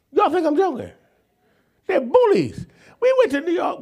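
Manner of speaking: high and playful, teasing the congregation; excited joking. Acted. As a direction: rouse the crowd with a joke. Preacher jokes, pitch jumps.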